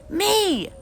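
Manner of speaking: put-on high, loud squeal of a toddler; demanding toddler. Toddler Fudge demanding his turn, high squeal. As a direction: claim it like a little brat.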